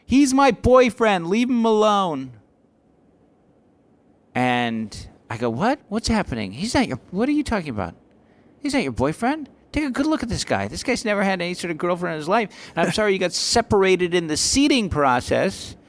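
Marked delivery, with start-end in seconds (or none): 0.0-2.3 high, loud, drawn-out mock yell; imitated girl yelling. Girl defending her boyfriend from the crowd, imitated yell. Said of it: "some girl yells out". 5.5-15.8 high-pitched, disbelieving comeback; retold incredulity. Comic doubting a heckler has any girlfriend, mock incredulous.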